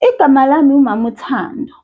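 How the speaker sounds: "Igama lami uMama Uthando" rattled off fast; quick answer. Shown as a demonstration. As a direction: answer briskly. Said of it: "quick answer before correction".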